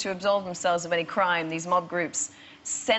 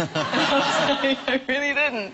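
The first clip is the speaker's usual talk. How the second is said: laughing it out, loud and slow; 7 semitones higher, 4 dB louder, much slower than usual. Real, not acted. [name] insisting to the waitress through loud laughter. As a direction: protest through real laughter. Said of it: "laughing reply".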